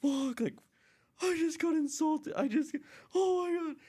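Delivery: very high, squealed dismay; hammed-up high-pitched meltdown; freaking out. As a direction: squeal it as if mortally insulted. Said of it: "I was freaking out".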